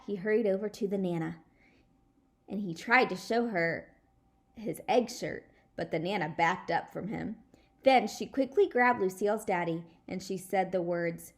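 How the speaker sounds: usual speaking voice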